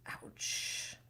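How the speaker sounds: breathy whispered wince; whispered ouch. Real, not acted. Host reacting to a painful reveal, whispered wince. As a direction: whisper it like it stings.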